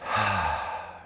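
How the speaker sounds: open-mouthed sigh, starting high and falling; audible sigh. Shown as a demonstration. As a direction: let the breath fall out in a sigh. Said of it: "and open the mouth".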